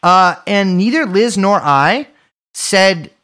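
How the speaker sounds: normal talking voice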